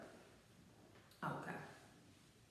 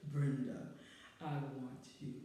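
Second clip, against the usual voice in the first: much lower and much softer, a hushed, breathy-sounding line; low seductive come-on; 8 semitones lower, 5 dB quieter than usual. Retold or imitated. The guy leaning in to tell his date, low hushed come-on. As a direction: purr it low like a smooth-talking date. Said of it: "Why does that sound scary?".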